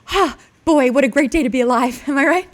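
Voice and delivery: high, loud, eager dog voice; an overexcited dog greeting his owner; dog Dwight. Retold or imitated. Playing the dog Dwight greeting Sam, high and eager. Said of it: "Can I do an impersonation?".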